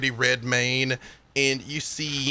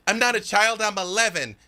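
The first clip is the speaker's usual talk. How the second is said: put-on indignant kid; 7 semitones higher than usual. Child protesting indignantly.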